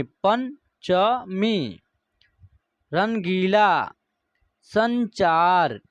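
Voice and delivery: Panchami stretched out very slowly; very slow repeat. Shown as a demonstration. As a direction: drag Panchami out syllable by syllable. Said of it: "उसके बाद".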